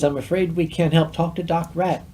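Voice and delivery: ordinary talk, nothing marked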